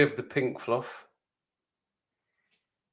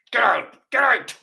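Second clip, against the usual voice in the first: high, loud hammed-up dismissal; outraged shout; 10 semitones higher, 4 dB louder than usual. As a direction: shriek it like an appalled teacher ejecting a pupil. Teacher throws the boy out.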